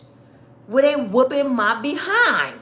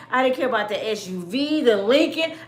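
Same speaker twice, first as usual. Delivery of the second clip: ordinary talk, nothing marked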